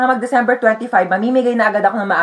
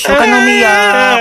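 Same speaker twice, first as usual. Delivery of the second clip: loud frantic hushing; 10 semitones higher, 6 dB louder than usual. Acted. Mother hushes a crying baby.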